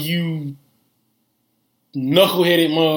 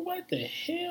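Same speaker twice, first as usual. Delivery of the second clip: shocked; 13 dB quieter, slower than usual. Retold or imitated. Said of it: "I'm like".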